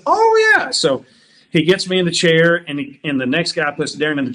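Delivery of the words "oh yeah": a much higher, louder eager "Oh, yeah"; squeaky, bright-eyed agreement; eager barber's oh yeah. Retold or imitated. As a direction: play the keen little barber nodding along. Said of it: "and he said".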